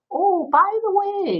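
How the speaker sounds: high, slow and light "Oh, by the way"; friendly inflection. Shown as a demonstration. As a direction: say "Oh, by the way" lightly and slowly, drawn out high. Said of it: "your voice to be able to say".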